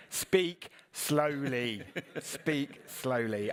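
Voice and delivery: very slow, drawn-out self-reminder; slow, deliberate speech. Retold or imitated. Speaker mocking own reminder to speak slowly, exaggeratedly slow. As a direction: spell it out syllable by syllable, stretching each word. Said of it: "can I speak a little slower?".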